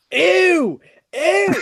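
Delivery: high, loud cry of revulsion; disgusted outburst. Retold or imitated. Student retelling stepping in poop, disgusted. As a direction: recoil loudly in exaggerated disgust.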